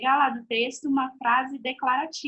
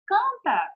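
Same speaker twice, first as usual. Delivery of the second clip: "Canta!" exclaimed much higher; exclamatory command; 9 semitones higher, 3 dB louder than usual. Shown as a demonstration. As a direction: burst out "Canta!" as an eager wish. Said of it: "tá expressando um desejo". (exclamação de desejo)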